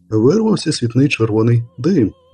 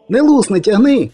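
much higher and louder, cutting him off; high insistent shout; 9 semitones higher, 3 dB louder than usual. Acted. Poet shouting down the witcher's warning, high and loud. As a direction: yell over him, sure of yourself.

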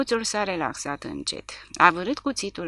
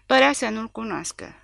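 usual speaking voice

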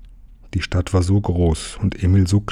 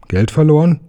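sharply higher, louder disbelieving question; incredulous echo; 9 semitones higher, 5 dB louder than usual. A narrator's reading. Conductor to boy claiming lost money, loudly incredulous. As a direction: throw it back as a doubting question.

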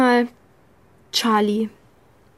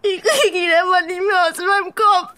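tearful, higher, voice breaking; crying; 5 semitones higher than usual. Acted. Alex crying as she tells Charlie about Benny. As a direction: push the words through sobs. Confessing the haunting voice.

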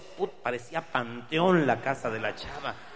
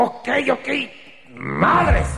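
higher, louder grudging okay; loud gruff relent; 13 semitones higher, 11 dB louder, much slower than usual. Acted. Attacker shouting as he gives up and lets her go. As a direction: give in with a shout.

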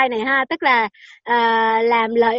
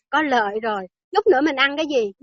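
normal talking voice

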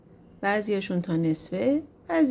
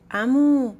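mildly raised, louder call; sudden shout; 3 semitones higher, 6 dB louder, much slower than usual. A narrator's reading. Bald shepherd suddenly calls after the departing Azrael, mild shout. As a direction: call out suddenly, a restrained storyteller's yell. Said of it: "یهو کچل داد زد".

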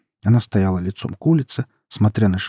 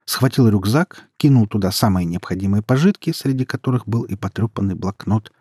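usual speaking voice

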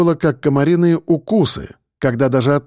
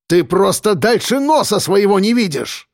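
higher, heated rant; mayor rages at the journalist in a raised voice; 6 semitones higher than usual. Acted. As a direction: lash out at her shortsightedness. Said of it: "разорялся мэр".

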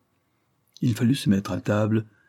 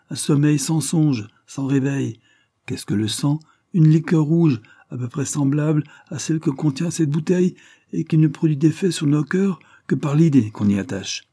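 higher, louder rhetorical declamation; lofty lecture on death and blood; revolutionary orator Delmare; 7 semitones higher, 4 dB louder than usual. A narrator's reading. Delmare philosophizes over wine about blood, declaiming.

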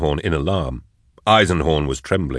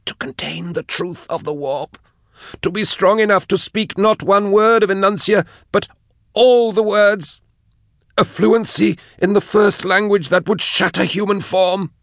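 very high, strained outpouring; tormented captive's high voice, swelling; moaning confession. A narrator's reading. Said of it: "she moaned".

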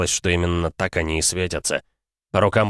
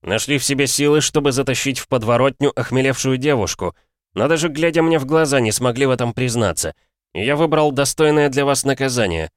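contemptuous anger; 6 semitones higher than usual. Acted. Hero condemns the cowards.

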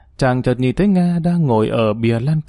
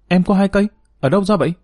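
higher-pitched astonished questions; surprised; 7 semitones higher, faster than usual. A narrator's reading. Song hears Nga keeps two guns, surprised. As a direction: ask with raised, startled pitch. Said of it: "Song ngạc nhiên".

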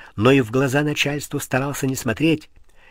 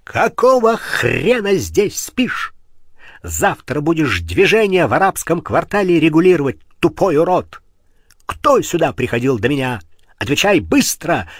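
furious shouting, louder and higher; berserk rage; 4 semitones higher, 5 dB louder than usual. Acted. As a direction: bark it at a sleeping guard. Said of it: "в бешенстве заорал".

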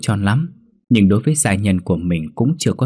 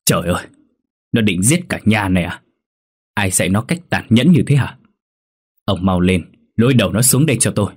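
shouting in anger. A narrator's reading. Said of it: "đập bàn quát lớn".

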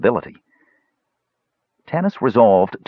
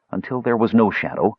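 plain reading voice

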